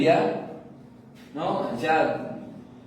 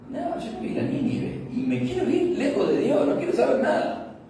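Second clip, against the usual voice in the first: higher, half-laughing stubborn Jonah; mock Jonah refusing; 10 semitones higher than usual. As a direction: play Jonah digging in his heels, lightly. Jonah voiced refusing God. (Jonás terco, imitado entre risas)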